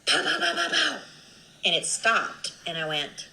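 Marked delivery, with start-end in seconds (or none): 0.1-1.0 high, loud mouth-made gunshot pops; imitated gunshots. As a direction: pop out each shot like a gun going off. Imitating gunshots she heard.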